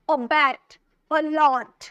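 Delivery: अबैट and allot, each with a punched last syllable; stress on second syllable. Shown as a demonstration. Teacher listing two-syllable examples, stressed last syllables in अबैट, allot. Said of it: "तो जोड़ दे रहे हैं आप".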